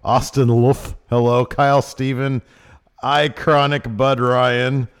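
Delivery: loud, booming roll call; announcer. Acted. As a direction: call out every name like an emcee.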